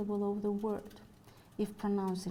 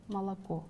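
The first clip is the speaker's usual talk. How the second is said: "молоко" stressed on its final syllable, КО; stress on last syllable. Shown as a demonstration. Lecturer gives a Russian example of free stress, last-syllable stress in "молоко". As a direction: put the weight on КО in "молоко". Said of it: "on the last syllable".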